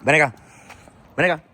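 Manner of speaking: firm call to come; stern owner command; serious dog voice. Real, not acted. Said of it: "That's my serious dog voice".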